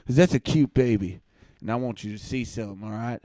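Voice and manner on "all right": normal talking voice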